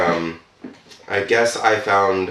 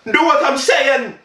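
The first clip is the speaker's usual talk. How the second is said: put-on bossy Trinidadian accent, much higher. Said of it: "I just stumbled over the accent".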